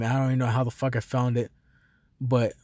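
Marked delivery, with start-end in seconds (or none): none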